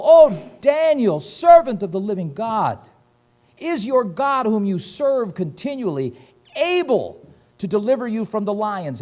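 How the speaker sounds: a king wailing for his friend at the den; lamenting cry. Acted. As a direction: cry out to Daniel in anguish. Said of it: "he cried with a lamentable voice".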